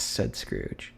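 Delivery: ordinary delivery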